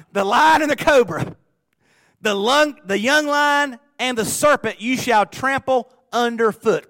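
loud, slow, high scripture proclamation; preaching at full force. Acted. Preacher proclaiming a psalm verse to the congregation, booming. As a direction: thunder the verse out to the church.

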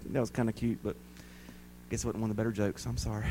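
low, soft, deflated aside; hushed, low admission; sheepish apology. Real, not acted. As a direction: back off quietly from a failed joke. Sheepish after joke falls flat.

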